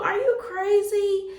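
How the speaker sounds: high, loud, drawn-out disbelief; incredulous retold outburst; alarmed protest. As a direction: stretch it out in acted-out outrage. Retold protest at girl's texting.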